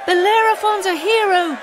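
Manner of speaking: a happy crowd hailing the hero; crowd cheering. Acted. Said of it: "they cried".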